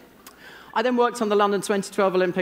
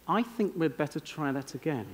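understated request; 2 semitones lower, 7 dB quieter than usual. Acted. Conductor to the orchestra after the first take, quietly understated. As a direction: say it quietly, as if reluctant to criticize.

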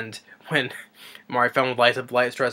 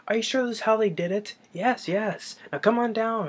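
much higher, nervous back-and-forth; imitating movie characters; 11 semitones higher than usual. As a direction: play both worried voices up high. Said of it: "he's like, you know".

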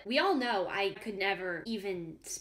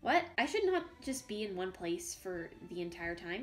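ordinary delivery

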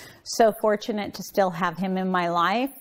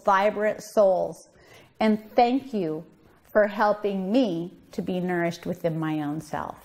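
ordinary delivery

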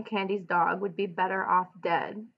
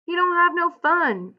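higher and louder, pressing the point; insistent; 9 semitones higher, 4 dB louder than usual. A narrator's reading. Carlson pressing Candy to shoot the old dog, insistent and loud. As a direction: push hard, not letting Candy off. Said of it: "Carlson insisted".

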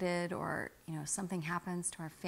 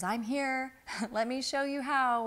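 a lightly raised, laughing voice of Spirit; lightly voicing Spirit with a laugh, higher; 7 semitones higher, 5 dB louder than usual. Spirit reassuring her, a light, higher laughing voice. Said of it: "Spirit says".

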